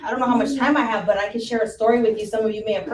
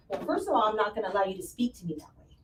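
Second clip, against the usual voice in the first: quieter, fast, composed rebuke; calm firm reply; 9 dB quieter, much faster than usual. Retold or imitated. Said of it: "So I took a breath".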